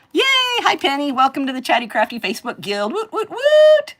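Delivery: high, loud cheering welcome, building to whoops; excited welcome. Real, not acted. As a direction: greet the newcomer with a big squeal. Greeting a new member excitedly.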